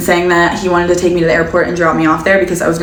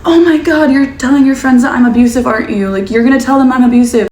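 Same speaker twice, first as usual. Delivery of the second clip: put-on angry boyfriend; 6 semitones higher, 3 dB louder than usual. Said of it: "he was like".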